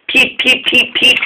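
high bright peeps, one after another; happy whistle. A narrator's reading. Happy engine whistling as he chuffs away. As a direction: toot happily like an engine. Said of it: "whistled Neville happily".

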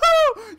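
high laugh. Real, not acted. Laughing after death.

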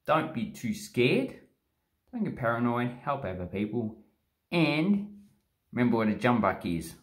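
ordinary delivery

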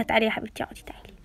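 soft unvoiced coaxing; whisper. Aunt whispering to the girl to sit. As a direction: whisper her over gently.

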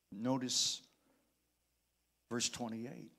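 ordinary delivery

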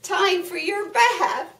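loud, high call to the animals. Acted. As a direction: call everyone in for bath time. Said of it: "Someone called".

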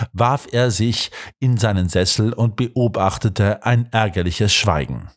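ordinary delivery

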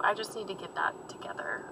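soft whispered self-talk; quiet determination, whispered; whispered resolve. Real, not acted. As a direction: murmur it to yourself. Resolving to stop biting nails.

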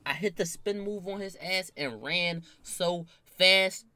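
ordinary delivery

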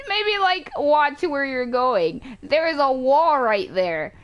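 high, loud, slow words through laughter; laughing scolding. Real, not acted. Laughing at crash into a wall.

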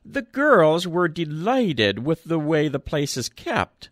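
put-on high piping old man; thin old voice, pleased; piped old voice. A narrator's reading. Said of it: "piped the old voice".

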